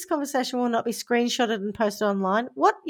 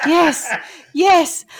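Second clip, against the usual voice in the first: higher, louder mock passion cries; mock ecstatic; 6 semitones higher, 7 dB louder, slower than usual. Victim gushing at her scammer, hammed-up ecstatic cries. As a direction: ham up breathless delight.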